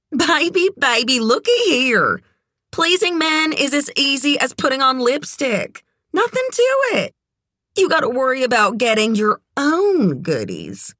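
high, confident know-it-all; brassy, worldly friend. A narrator's reading. Red schools Kit on pleasing men, brassy and sure. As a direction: set the novice straight, pitched well up. Red's character voice, advising Kit.

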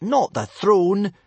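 high, slow, loud correction; scold, spelling it out. Acted. Boy correcting his pet dragon, scolding. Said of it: "scolded Hiccup".

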